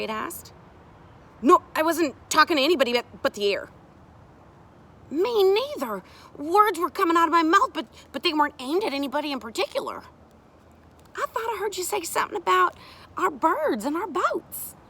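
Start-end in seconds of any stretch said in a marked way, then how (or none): 1.4-3.7 higher, louder, hurried denial; defensive child. Acted. A child caught touching things, denies it. 5.1-10.0 high, loud, stumbling excuse; flustered child. Acted. Second child joins the denial. 11.2-14.7 high-pitched, lilting probe; casually fish for a confession; inquiring woman character. Acted. Sari gently probes the children.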